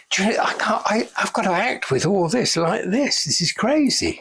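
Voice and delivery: a lightly voiced, fed-up star grumbling about the rig; exasperated actor. Retold complaint from the actor on set.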